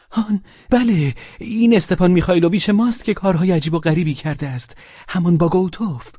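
a flustered husband spilling news; agitated Pavel voice. Acted.

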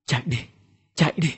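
soft, weak plea through tears; an understated tearful warning; tearful urging. A narrator's reading. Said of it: "Anh vừa khóc vừa nói". (giọng yếu ớt như đang khóc)

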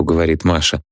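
ordinary delivery, nothing marked

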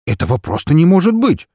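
высоко и громко, отказываясь верить; выкрикни, не веря; потрясённое неверие. Acted. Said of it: "повторил главврач". (главврач в шоке повторяет)

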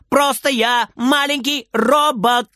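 put-on high, slow kid's call; high drawn-out shouted reply; shouting back. A narrator's reading. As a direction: call it out proudly, word by word. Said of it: "кричит Фридер в ответ".

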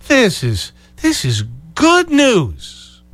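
drawn-out, high mocking "good news"; mock gloating. Host mocks the pundits' gloating line, drawn-out and high. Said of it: "the comment last night that this".

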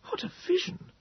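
soft, airy whispered exclamation. A narrator's reading. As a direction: breathe it out in a whisper. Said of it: "Moses whispered to me".

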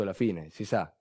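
ordinary delivery

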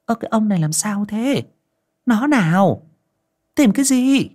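high-pitched nagging wife; old wife nags her husband, high and quick; scolding wife. Acted. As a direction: nag him in a high voice.

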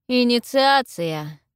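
ringing, drawn-out declaration; loud pronouncement. A narrator's reading. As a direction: pronounce it loudly and slowly. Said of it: "громко произносит Картик".

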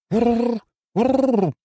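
high rolled "urr" of an offended bird; high-pitched indignant cooing growl; indignant bird trill. A narrator's reading. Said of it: "возмущённо проурчал пернатый".

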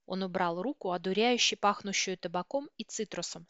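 plain reading voice

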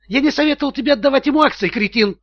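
high, exasperated comeback; indignant yell. A narrator's reading. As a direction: yell back at an idiot. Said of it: "завопил в ответ финансовый директор". (возмущённый вопль в ответ)

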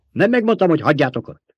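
high, loud indignant question; sharp reproach. A narrator's reading. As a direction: demand to know why they disobeyed. Commander rebukes men who disobeyed.

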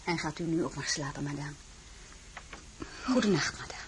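soft, fading to a whisper; hushed. Acted. Désirée hushes the empress goodnight as she drifts off. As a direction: ease her off to sleep.

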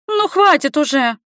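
put-on high, pleading girlish voice; girl's plaintive high-pitched complaint; plaintive squeak. A narrator's reading. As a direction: beg him to stop in a small high voice. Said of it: "жалобно пропищала Соня".

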